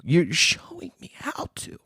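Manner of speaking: soft unvoiced whisper, half to himself; whispered aside. Whispering to his mom about the evaluation.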